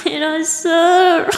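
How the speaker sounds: pained groan. Acted. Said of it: "दीदी ऐसे चिल्लाने लगती है".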